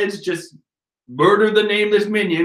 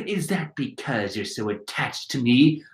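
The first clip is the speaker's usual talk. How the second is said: lower, softer, gentler delivery; her character voice; 6 semitones lower, 6 dB quieter than usual. Acted. Redoing the line as her, soft low voice. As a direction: say it quietly as the woman in the manga. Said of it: "Oh, wait, no, that's her".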